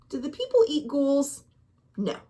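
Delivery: ordinary delivery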